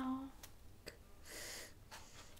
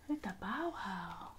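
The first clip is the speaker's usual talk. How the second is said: whispering; 7 semitones lower, 7 dB quieter, faster than usual. Real, not acted. Whispering to toddler.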